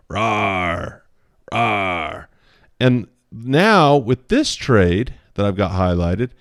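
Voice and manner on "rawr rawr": playful little rawr while drawing; mock monster growl. Teacher drawing the red monster, playful mock growl. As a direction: growl like a cartoon monster, lightly. Same type of monster over here.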